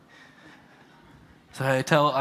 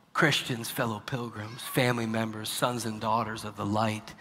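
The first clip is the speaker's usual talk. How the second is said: ordinary talk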